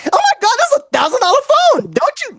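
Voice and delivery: put-on high girly squeal; thrilled high girl voice; excited girlfriend. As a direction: squeal over the new phone.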